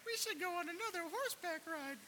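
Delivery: very high, squeaky falsetto impression; mock a partner in an exaggerated falsetto; mimicking Don. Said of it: "that's how she sounds".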